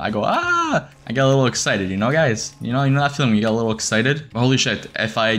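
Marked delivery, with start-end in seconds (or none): 0.2-0.9 imitating own high-pitched yelp; mock freak-out scream. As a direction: squeal it high, poking fun at yourself. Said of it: "I start freaking out. I go".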